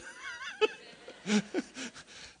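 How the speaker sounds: squealing high laugh. Real, not acted. Preacher laughing at his own kids story, squealing.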